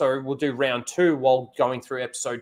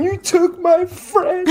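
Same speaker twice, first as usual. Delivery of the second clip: high, slow, laughing cry of grief; mock anguished wail; 5 dB louder, slower than usual. Playing the grieving friend at the tree, laughing high wail.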